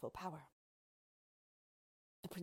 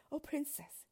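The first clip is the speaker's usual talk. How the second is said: higher-pitched plea to a princess; pleading cry; 8 semitones higher than usual. A narrator's reading. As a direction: cry out imploringly, voice lifted. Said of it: "he cried".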